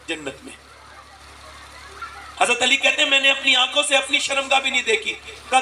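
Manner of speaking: usual speaking voice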